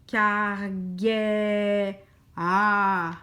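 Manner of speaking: CARGA- drawn out, holding the second vowel; sounding out. Shown as a demonstration. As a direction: stretch CARGA- to listen for the next sound. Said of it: "je vais écouter l'autre lettre".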